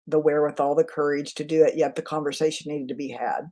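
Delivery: ordinary talk, nothing marked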